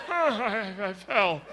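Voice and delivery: gasping for air while talking; acted knocked-breathless croak; winded. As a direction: squeeze the words out with no breath. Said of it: "knocked the wind out of yourself".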